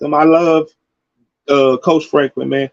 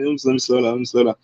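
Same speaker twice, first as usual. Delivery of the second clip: softer, lower, reining himself in; softer self-restraint after getting carried away; calming himself down; 6 semitones lower, 6 dB quieter, much faster than usual. Real, not acted. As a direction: quietly tell yourself to cool off.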